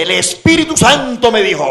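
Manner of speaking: high, loud mock revelation; mimicked church member. Preacher imitating people in church claiming revelation, mock proclamation. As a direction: imitate a churchgoer announcing a word from heaven. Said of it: "uno escucha gente en la iglesia que dice".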